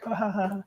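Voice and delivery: high, soft mock giggle; retold "ha ha" laughter; imitated laugh. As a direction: imitate her polite laugh, high and light. Imitates translator laughing at banter.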